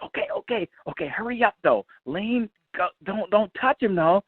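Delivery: high, hurried, anxious engineer voice; nervous engineer. Retold or imitated. Engineer hurrying the guitarist, pitched high and anxious.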